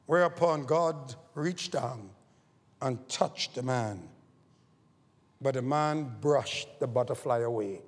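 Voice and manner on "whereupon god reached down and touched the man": usual speaking voice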